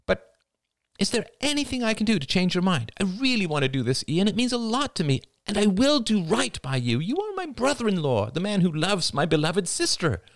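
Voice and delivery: high, effusive, insistent wheedling; pleading. Acted. Rachel begging her brother-in-law to relent, pleading. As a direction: lay on the persuasion in a rush.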